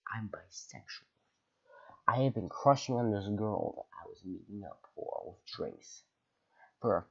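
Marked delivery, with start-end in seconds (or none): 0.1-1.1 hushed whispered confession; whispering. Real, not acted. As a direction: whisper it like a secret. Confession whispered mid-story.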